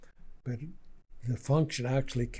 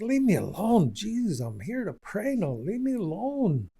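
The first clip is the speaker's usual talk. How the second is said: sharp, high, loud shoo-away; irritated at ants; 8 semitones higher, 4 dB louder than usual. Retold or imitated. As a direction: snap at the pesky ants to leave. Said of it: "I was getting mad at the ants".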